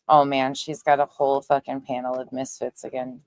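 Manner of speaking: ordinary delivery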